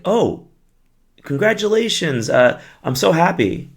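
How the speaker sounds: high, gushing congratulations put on for show; hammed-up happy well-wishing; mock congratulations. Acts misplaced congratulations to mourner.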